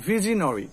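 ভিজনারি ending in rounded "no-ri"; correct pronunciation. Shown as a demonstration. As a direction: round the vowel before ri in ভিজনারি. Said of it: "কি হবে উচ্চারণ".

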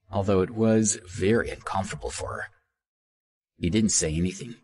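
usual speaking voice

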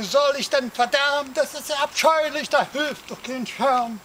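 hoch und laut jammernd geschrien. A narrator's reading. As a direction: verzweifelt gegen den Regen anschreien. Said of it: "schrie der Arme". (höher gejammerter Schrei, halb gespielt)

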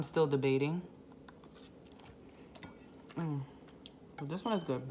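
talking between bites; chewing food. Real, not acted. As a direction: mouth full of noodles. Seen 1: Noodles enter mouth at -3; closed lips working through labelled words.

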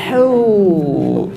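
"Hu" as a long exhaled huu; ha with dhammah. Shown as a demonstration. Teacher showing breathing practice, long exhaled "Hu". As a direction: breathe out slowly through a drawn "Hu". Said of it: "Domah, domah".

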